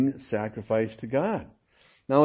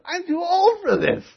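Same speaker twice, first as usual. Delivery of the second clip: high, comic whine; griping about moving. Retold or imitated. Preacher retelling his own high whine while helping a move. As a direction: squeak it out like a worn-out old man. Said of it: "I said".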